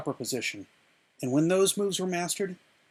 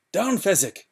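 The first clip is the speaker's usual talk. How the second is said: louder, urgent shouted order; yelled command; 5 semitones higher, 7 dB louder than usual. A narrator's reading. Inigo ordering the frozen Fezzik down, yelling. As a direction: bark the order at the frozen giant. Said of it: "And he yelled".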